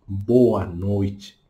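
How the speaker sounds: Boa noite slow and lengthened; drawn-out Portuguese greeting. Shown as a demonstration. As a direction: stretch Boa noite, Brazilian rhythm. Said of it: "Português". (Boa noite devagar e alongado)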